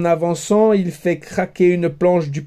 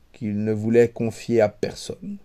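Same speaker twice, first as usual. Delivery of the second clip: ordinary delivery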